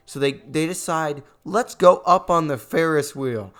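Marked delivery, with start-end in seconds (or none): 1.5-3.5 loud mocking imitation; mad, mocking quote. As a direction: quote their decision loudly, fed up. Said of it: "getting hoarse from getting mad".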